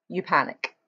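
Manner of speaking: ordinary delivery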